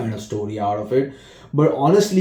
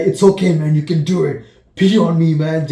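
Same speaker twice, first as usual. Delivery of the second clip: raised, louder pep-talk voice; excited encouragement; 5 semitones higher, 5 dB louder than usual. Retold or imitated. Retelling how a friend urges him on, mock excited. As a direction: cheer him on like a buddy. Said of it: "And then he's like".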